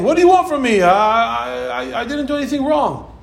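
high, exasperated defense; defensive protest. Retold or imitated. A scolded child defending himself, high and exasperated. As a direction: throw up your hands, protesting innocence. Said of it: "defending yourself by saying".

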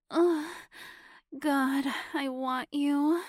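high, loud moan. Acted. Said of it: "Sabrina moaned".

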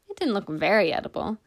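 ordinary delivery, nothing marked

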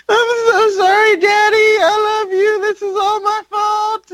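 high-pitched, wailing fake cry; hammed-up weepy daughter at a casket; mock sobbing. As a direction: blubber it out in an exaggerated falsetto. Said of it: "and she's sobbing".

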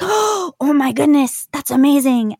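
high, slow, gushing amazement; imitating amazed reaction. Retelling how Chinese speakers react, high slow amazed gush. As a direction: act out strangers dazzled she speaks Chinese. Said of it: "the reactions are just always like".

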